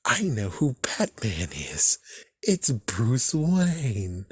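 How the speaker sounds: slow, drawn-out villain taunt; Riddler. Retold or imitated. Villain claiming he knows Batman's identity, slow and drawn out. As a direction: stretch every word like a knowing villain. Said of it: "the Riddler was smart enough to be like".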